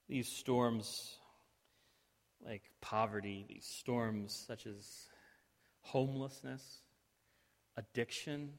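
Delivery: pastoral prayer. Acted. Leads closing prayer.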